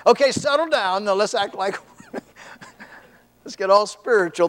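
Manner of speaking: high, playful put-on holiness; teasing the congregation with a fake-holy act; mock pious. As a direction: pretend to get all spiritual. Family acting pious.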